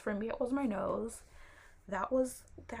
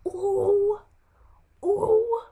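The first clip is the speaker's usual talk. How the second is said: loud, high-pitched ooh; exasperated ooh; 11 semitones higher, 12 dB louder than usual. Real, not acted. She vents to viewers about prednisone, exasperated. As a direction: let out two big high oohs of exasperation.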